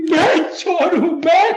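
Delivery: tearful, wounded disbelief; anguished crying. Acted. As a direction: break down over the accusation. Father breaks down, branded thief.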